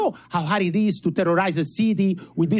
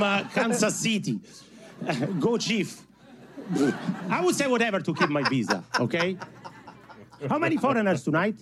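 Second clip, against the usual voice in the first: usual speaking voice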